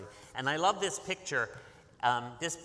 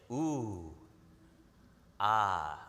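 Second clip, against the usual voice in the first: ooh ah of admiration; 6 semitones lower than usual. Shown as a demonstration. Teacher showing kids how to admire art, ooh and ah. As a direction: show awe at the artwork. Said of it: "you can say".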